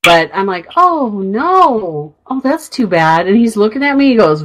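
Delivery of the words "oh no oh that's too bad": higher, drawn-out mock "oh no"; insincere sympathy. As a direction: feign sympathy you clearly don't mean. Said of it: "Say it like you mean it".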